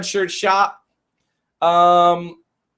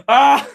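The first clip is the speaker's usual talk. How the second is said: startled yelp; 7 semitones higher, 5 dB louder than usual. Real, not acted. Dizzy after spinning in chair.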